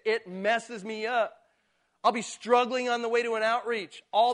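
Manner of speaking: loud, high passionate preaching; fervent preaching. Real, not acted. Pastor testifying to the joy of witnessing, fervent. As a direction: swell up with conviction. Preacher rising to full force.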